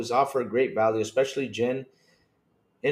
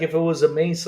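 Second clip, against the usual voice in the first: ordinary delivery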